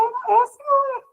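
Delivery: very high-pitched child's reply, lightly imitated; young daughter's answer. Daughter answers mother about her drawing, lightly voiced child.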